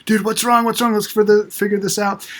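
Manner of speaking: high, urgent questioning; eager fix-it dad. Retold or imitated. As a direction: rush to solve it. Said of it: "And I'm like".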